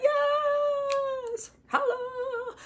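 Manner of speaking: very high, drawn-out cheer; celebratory squeal. Acted. Group cheering a member's vaccine photo, high celebratory squeal.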